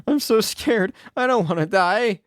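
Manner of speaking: high, frightened whimpering voice; gasp, then plead in terror; scared gasp. Acted. Captive girl fearing the demons, high and frightened. Trapped in demon jail cell.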